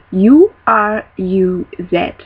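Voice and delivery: each letter slow and separate; spelled out. Shown as a demonstration. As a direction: spell the letters out one by one, slowly. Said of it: "spell it out for you".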